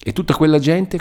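high-pitched fearful question; frightened. A narrator's reading. Captive asking about the villain's men, frightened. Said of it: "con le labbra contratte e tremanti di spavento e d'orrore".

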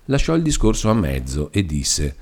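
ordinary delivery, nothing marked

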